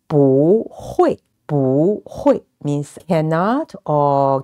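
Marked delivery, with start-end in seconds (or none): 0.1-2.4 "不" rises, then "会" falls; changed second tone. Shown as a demonstration. Teacher modelling the same tone change, "不" rising before "会". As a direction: lift "不", then drop "会". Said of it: "And the same thing".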